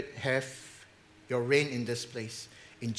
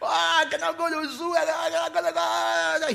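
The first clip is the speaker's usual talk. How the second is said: put-on much higher childish wail; like a little child; 13 semitones higher than usual. Pastor imitates a crying child wanting the zoo. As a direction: whine like a child denied the zoo. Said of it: "it's like a little child".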